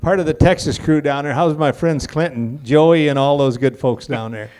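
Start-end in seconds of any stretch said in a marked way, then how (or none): none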